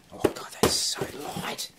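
hushed whispered amazement; awed whisper. Real, not acted. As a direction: breathe it out in disbelief. Awed by the parcel's weight.

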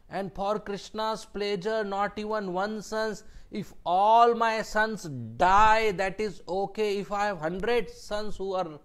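loud, impassioned insistence; preacher-like outburst of resolve; fervent declaration. Acted. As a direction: declare total devotion, voice swelling to a peak. Acted devotee's fervent declaration to family.

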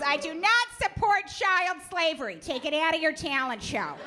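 a lightly voiced stern mother; mom voice. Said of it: "She goes".

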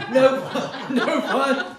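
loud, laughing through the words; laughing at own pun. Real, not acted. Group leader laughing loudly at his own accidental pun. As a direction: crack up while insisting no pun.

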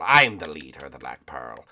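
deep, emphatic pirate captain; Captain Barbossa. Pretend. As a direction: assert command in a low pirate voice. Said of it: "I'm Captain Barbossa".

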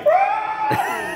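long, very high screech; imitated bird screech. Storyteller imitating the hawk caught in his arms, high screech. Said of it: "Sam's like".

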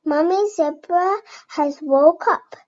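usual speaking voice

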